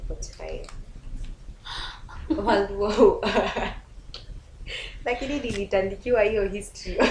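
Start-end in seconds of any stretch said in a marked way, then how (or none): 2.3-3.7 words broken up by laughter; laughing. Real, not acted. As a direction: say it while cracking up. Laughing about the history question.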